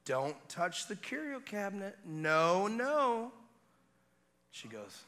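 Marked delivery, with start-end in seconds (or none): 0.0-3.3 high, drawn-out no-no warning; parental warning. Retold or imitated. Father to baby nearing the cabinet, high warning. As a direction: warn a baby off, pitch climbing on each no.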